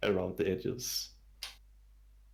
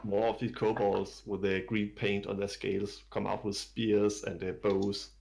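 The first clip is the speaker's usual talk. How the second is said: ordinary talk, nothing marked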